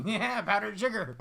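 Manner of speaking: playing a proud cheap user; mock druggie. Preacher's kid mocking his poor stash. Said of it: "So I'm like".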